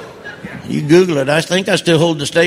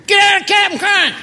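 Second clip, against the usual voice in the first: shrill-free squawk, pitched way up; wife yelling; 14 semitones higher than usual. Retold or imitated. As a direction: shout it like a startled wife catching him. Wife yelling at him in story.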